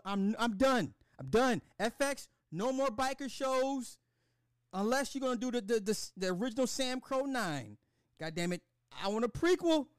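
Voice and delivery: high-pitched exasperated protest; spazzing out. Real, not acted. Fed-up fan demanding a prequel from the network, high exasperated rant. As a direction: plead and complain with the voice climbing. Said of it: "spazzing like this".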